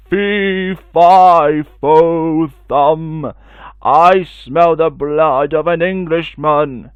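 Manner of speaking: loud, slow giant's roar; booming giant. Acted. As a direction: boom each word like a hungry giant. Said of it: "a loud booming voice".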